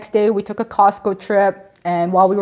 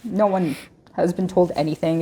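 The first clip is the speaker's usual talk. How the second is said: ordinary delivery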